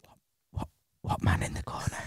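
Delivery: frightened whisper from under the covers; scared whisper. Retold or imitated. Under the covers, asking his girlfriend about the man, whispered in fear. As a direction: breathe the question, voiceless and afraid.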